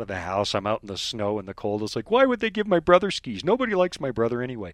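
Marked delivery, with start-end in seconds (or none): 2.1-4.7 put-on indignant kid's complaint, starting higher; mock sulky protest, mildly angry; retold childish anger. Recalling storming out into the snow as a child, mock angry. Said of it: "getting angry. I storm out".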